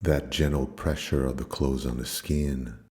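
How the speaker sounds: low, calm meditation voice with long pauses; low-pitched, soothing yoga nidra guidance; guided meditation. Acted.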